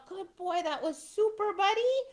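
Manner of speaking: high, sing-song dog praise; praising the dog. Shown as a demonstration. Handler to dog after the exercise: high excited praise. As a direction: gush at a good dog, pitch way up.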